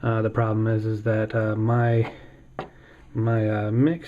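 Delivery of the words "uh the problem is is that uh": ordinary delivery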